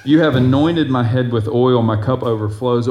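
loud, declaiming psalm reading; scripture reading. Acted. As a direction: proclaim the verse to the congregation. Rereads verse.

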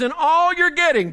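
high, loud surge of preaching; preacher's loud proclamation. Real, not acted. Preacher quotes the Bible on wisdom, proclaiming it high and loud. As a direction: ring the verse out over the congregation.

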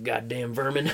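low, soft cowboy grumble; muttered cowboy curse. Retold or imitated. Cowboy spotting a rat by the fire, muttered annoyance. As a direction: curse under your breath as a cowboy.

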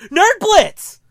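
loud, high delighted shout of recognition; excited shout. Retold or imitated. Artist spotting the podcast shirt, shouts in delight. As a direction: blurt it out, pointing at the shirt.

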